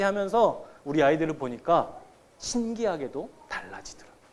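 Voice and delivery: ordinary talk, nothing marked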